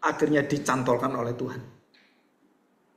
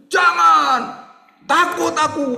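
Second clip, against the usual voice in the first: put-on high, loud child's scream; teriakan anak ketakutan; 13 semitones higher, 6 dB louder, slower than usual. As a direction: act out a terrified child yelling. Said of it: "Anak itu teriak-teriak apa?". (teriak ketakutan seperti anak kecil)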